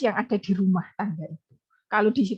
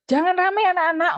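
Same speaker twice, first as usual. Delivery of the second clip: higher, louder call to a noisy class; raised classroom call; 9 semitones higher, 4 dB louder than usual. Shown as a demonstration. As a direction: hush the class with only a bare "jangan rame". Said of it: "kalau kalian ngajar".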